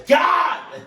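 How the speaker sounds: sudden loud, high cry; real overwhelmed yell; raw emotional shout. Real, not acted. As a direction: explode on the word in frustration. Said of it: "emotion that you all getting from me".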